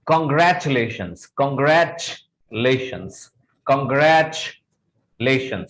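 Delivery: congratulations as "kun-GRATCH... LAY-shuns", slowly; correct pronunciation. Shown as a demonstration. Teacher telling students to split it, carefully modelled. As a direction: break congratulations in two after "gratch". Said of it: "so you can say".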